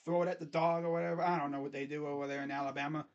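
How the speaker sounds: usual speaking voice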